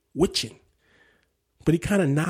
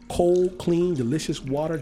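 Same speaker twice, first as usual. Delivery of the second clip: ordinary delivery, nothing marked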